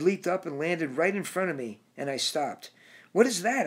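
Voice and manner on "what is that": high, loud cry of alarm at the creature; frightened scream. A narrator's reading. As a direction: shout it out in fright, higher and louder. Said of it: "I screamed to the turtle".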